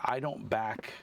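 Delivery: ordinary talk, nothing marked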